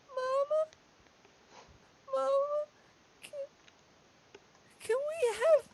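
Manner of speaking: put-on very high, tiny halfling child voice; tearful little kid. Tearful child asking mama for pancakes, plaintive. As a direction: plead to mama like a confused little one. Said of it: "He's got tears going down his face".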